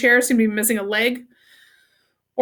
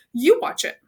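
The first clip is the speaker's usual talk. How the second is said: plain reading voice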